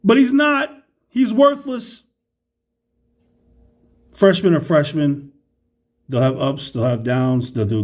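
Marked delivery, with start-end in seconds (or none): 0.0-2.0 frustrated outburst. Real, not acted. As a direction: blurt it out in frustration. Ranting about a player.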